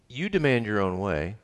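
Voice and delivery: usual speaking voice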